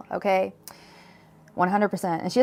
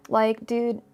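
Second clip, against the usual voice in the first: ordinary talk, nothing marked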